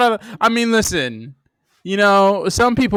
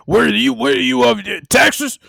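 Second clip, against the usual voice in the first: hyped wrestler shout; 7 semitones higher than usual. Retold or imitated. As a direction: pump it up like a wrestler. Hyped wrestler promo bit.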